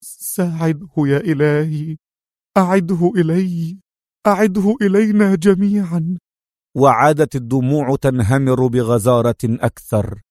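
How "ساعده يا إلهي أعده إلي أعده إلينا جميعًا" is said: heartfelt prayer. A narrator's reading. Woman at his bedside prays for him, pleading high. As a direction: cry out to God from the depths. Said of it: "وهتفت من أعمق أعماقها".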